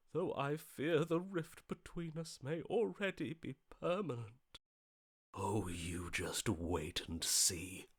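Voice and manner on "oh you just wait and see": low, slow and quiet, full of menace; a low, slow murmured warning of what is coming; ominous threat. Acted. Ominous threat after tense argument.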